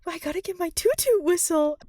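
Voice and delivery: put-on gleeful childish announcement; excited Sabrina. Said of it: "And then she's like".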